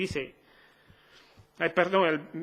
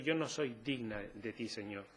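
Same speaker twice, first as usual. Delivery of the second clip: humble plea; 5 semitones lower, 7 dB quieter than usual. Acted.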